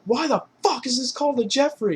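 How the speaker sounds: high-pitched mock freak-out; Jonah Hill. Panicked man questioning the drug's name, high mock freak-out.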